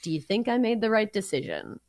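ordinary talk

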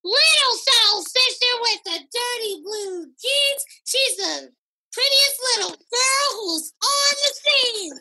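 put-on high, slow, sing-song little girl reciting; toddler reciting a poem. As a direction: recite it like a proud three-year-old. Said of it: "And she was like".

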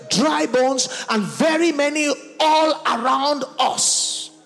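loud, high, ringing plea to God; impassioned preaching prayer. Real, not acted. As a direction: build a forceful cry over the congregation. Preaching prayer at full force.

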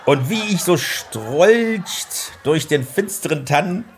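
high, loud, slow booming Santa; hammed-up Santa voice. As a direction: drawl the poem out like a grand Santa. Father as Santa reciting poem.

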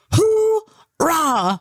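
high mock-posh cheer of a toff; posh English cheer. Guest imitating posh ex-boyfriend's cheer, in a high mock-posh voice. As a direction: bellow it like an upper-class twit celebrating. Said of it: "so posh that when he would come".

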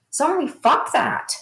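rally them to speak up, full conviction; fired-up outburst. Real, not acted.

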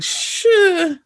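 high, drawn-out sarcastic sure; mock agreement, dripping with doubt. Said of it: "It's like".

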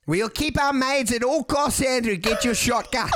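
mock rallying cry in South African accent. Shown as a demonstration. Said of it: "could you do an impression".

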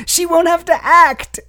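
high, loud mock sales pitch; hammed-up Selznick pitch. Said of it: "So he was like".

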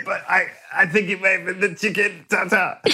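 high, pitiful little voice; feeble dying man. Pretend. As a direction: plead weakly through stomach pain. Dying Sam voiced in story.

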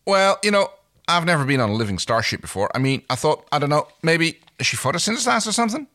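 high, fast flustered rambling. Acted. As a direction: scramble for an excuse. Crichton flustered, defending ignorance.

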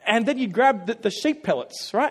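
usual speaking voice

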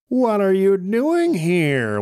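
high, slow witch voice; a screechy witch demanding answers. A narrator's reading. Witch confronting the travelers, high and slow. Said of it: "one of the witches asked".

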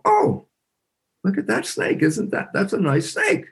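high, light admiring coo; pleased admiration. Retold or imitated. Grown son of biologist sees snake, pleased.

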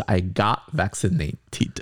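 "I got vaccinated" said slowly, each word distinct; careful slow demo of "I got vaccinated"; slow model phrase. Shown as a demonstration. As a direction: show the learner the full phrase, slowly. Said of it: "后面那个vaccinate要加一个D".

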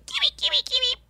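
tiny, very high bird voice; parakeet imitation. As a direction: chirp it like a talking parakeet, softly. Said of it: "he just was like".

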